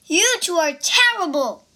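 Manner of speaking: scolding monk. Acted. Monk scolds his disciples.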